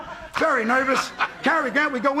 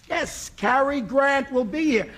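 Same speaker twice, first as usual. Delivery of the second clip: slow emphatic; 2 semitones higher, slower than usual. Retold or imitated. Guard repeats slowly, patiently.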